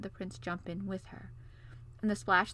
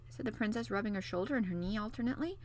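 ordinary delivery, nothing marked

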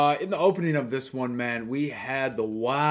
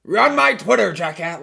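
loud high shouted order; yelled command, hammed-up; mock yell; 6 semitones higher, 9 dB louder than usual. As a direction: bark it like an angry boss. Imagined boss yelling at staff.

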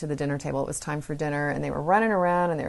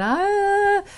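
high, loud whiny wail; child screaming; 14 semitones higher, 4 dB louder than usual. Retold or imitated. Daughter screaming again at mom, loud high whine. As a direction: wail it like a child denied a treat. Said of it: "then she'd scream again".

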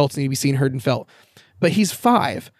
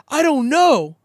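put-on much higher, whiny little-kid voice; sulky kid; 11 semitones higher, 3 dB louder, much slower than usual. Uncle retells nephew's tantrum, high whiny kid voice. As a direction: play the sulky five-year-old.

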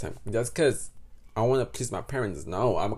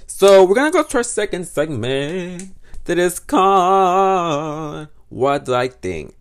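slow, loud show-host announcement; announcer voice; 6 semitones higher, 9 dB louder, slower than usual. Acted. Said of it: "announces segment".

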